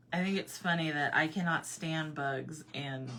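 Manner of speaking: ordinary talk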